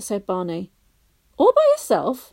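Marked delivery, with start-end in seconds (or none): 1.4-2.3 higher and louder, astonished; surprised exclaim. A narrator's reading. Lou amazed Barney went alone, higher surprised voice. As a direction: gasp it out in surprise. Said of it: "exclaimed Lou".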